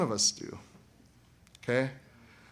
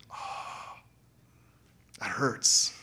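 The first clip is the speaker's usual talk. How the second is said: breathy, hushed wince; a soft wounded aside; pained aside; 2 semitones lower, 4 dB quieter, much slower than usual. Real, not acted. Preacher reacting to the girlfriend excuse, hushed.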